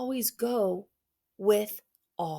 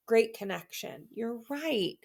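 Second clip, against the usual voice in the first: normal talking voice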